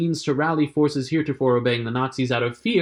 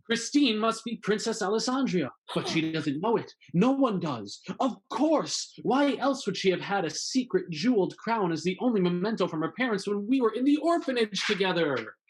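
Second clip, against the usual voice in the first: higher, dawning discovery; Marius realizes; 7 semitones higher, 5 dB quieter than usual. Pretend. Marius realizing Christine is the princess, excited. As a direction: hero putting it all together.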